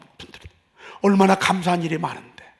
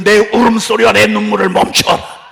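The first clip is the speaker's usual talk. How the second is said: loud, slow, higher divine command; voice of the Lord commanding; 6 semitones higher, 10 dB louder, slower than usual. Acted. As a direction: thunder God's order to stop crying. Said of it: "주님이 그래서".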